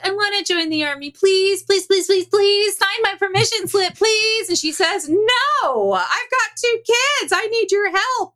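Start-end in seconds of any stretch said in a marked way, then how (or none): 0.1-4.5 high, whiny mock begging; hammed-up imploring husband; mock pleading. Husband begging his wife, acted. 5.1-8.3 high-pitched mock refusal; hammed-up pleading objection; exasperated wife, imitated. Wife refusing husband, acted.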